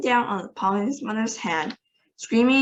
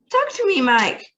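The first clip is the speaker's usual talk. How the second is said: a much higher, louder pleading cry; cried; 11 semitones higher, 5 dB louder than usual. A narrator's reading. Mother begging her tiny son to answer, high loud cry. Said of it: "cried Missus T V".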